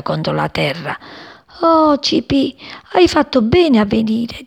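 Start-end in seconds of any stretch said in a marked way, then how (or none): 1.6-4.4 high plaintive little voice; dying flower. A narrator's reading. Freed dying daisy thanks her sparrow friend, high and plaintive. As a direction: speak high, with grateful relief. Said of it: "disse appena fu liberata".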